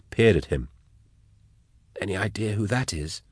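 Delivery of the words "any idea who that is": soft, hushed question; whispered. Acted. Drusha whispering to Nayl about the caged man, hushed. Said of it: "he whispered to Nayl".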